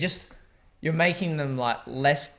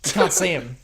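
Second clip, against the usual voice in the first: ordinary talk, nothing marked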